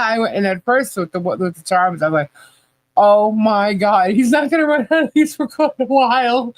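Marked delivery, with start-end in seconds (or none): 3.0-6.5 amazed. Retold or imitated. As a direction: marvel at the huge pile. Reacting to many charms.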